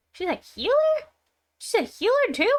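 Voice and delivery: high, incredulous pitch leap; surprised question. Real, not acted. Player learning she's a healer too, surprised. Surprised reading skill description.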